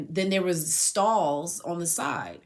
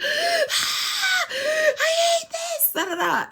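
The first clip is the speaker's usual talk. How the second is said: high wailing sob, half laughing; mock sobbing wail; 7 dB louder, much slower than usual. Telling friends about jail van, a loud high sobbing wail. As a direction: act out the crying in the truck, loud and high. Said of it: "I just started crying".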